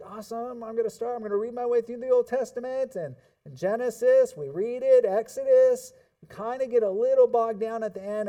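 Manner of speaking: high-pitched eager enthusiasm; acted-out excitement, pitched well up; eager Bible reader. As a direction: gush like an excited new reader. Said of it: "We're like".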